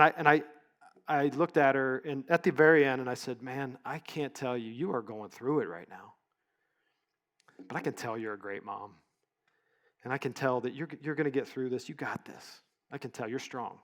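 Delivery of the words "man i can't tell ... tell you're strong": tender encouragement. Retold or imitated. Encouraging a struggling mom.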